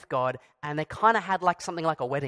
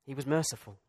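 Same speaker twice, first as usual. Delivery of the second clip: usual speaking voice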